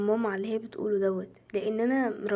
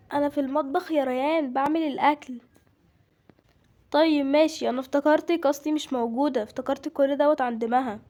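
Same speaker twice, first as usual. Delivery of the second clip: loud, slightly raised household voice; busy mother; 4 semitones higher, 5 dB louder than usual. Acted. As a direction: talk up over the child and across the house.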